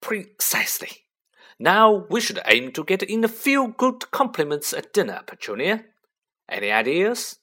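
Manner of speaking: high blustering uncle voice; pompous uncle, lightly voiced; Uncle Vernon voice. A narrator's reading.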